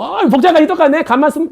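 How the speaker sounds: high, exclaiming mock astonishment; acted amazement. Teacher re-enacting spotting an identical outfit, amazed. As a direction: pitch right up in playful disbelief. Acts out reaction to lookalike outfit.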